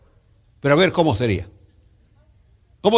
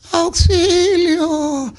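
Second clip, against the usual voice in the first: weakened cry; 13 semitones higher, much slower than usual. Acted. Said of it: "Está debilitado".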